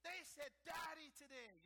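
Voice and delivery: high, loud, slow cry of delight; overjoyed shout. Retold or imitated. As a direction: shout it like a proud father bursting with joy. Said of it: "And God is like".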